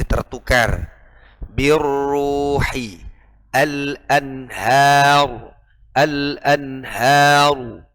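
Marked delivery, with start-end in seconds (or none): 1.5-7.9 Birruhi al-anhar recited slowly with the proper ra; drawn-out recitation of al-anhar; slow correct Quranic recitation. Shown as a demonstration. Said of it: "Tidak boleh tipis".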